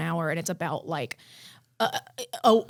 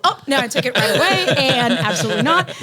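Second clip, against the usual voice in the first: laughing; 7 dB louder than usual. Real, not acted. Laughing at own casting.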